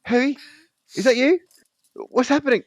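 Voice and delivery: put-on high, loud, flustered boy; flustered Neville. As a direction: stumble in, apologizing in a high voice.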